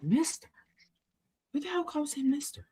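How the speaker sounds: scoffing at the name; incredulous. Retold or imitated.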